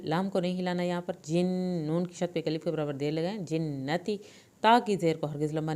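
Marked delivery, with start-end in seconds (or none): none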